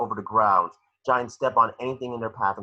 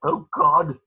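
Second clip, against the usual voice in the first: alarmed exclamation; 8 semitones higher, 6 dB louder than usual. Acted. Neville reacts to Seamus.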